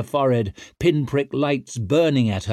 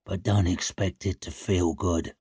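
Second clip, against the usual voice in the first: low grim voice; 4 semitones lower, 4 dB quieter than usual. Acted. Shivers speaks.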